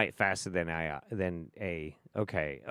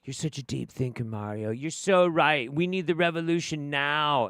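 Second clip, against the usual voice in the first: gushing fan; 4 semitones higher than usual. Retold or imitated. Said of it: "Hundreds of people like".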